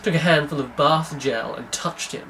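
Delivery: usual speaking voice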